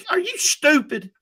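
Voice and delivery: high, disbelieving jab; incredulous. Real, not acted. Host mocking Krzyzewski's hypocrisy, incredulous high scoff. Exasperated rant about hypocrisy.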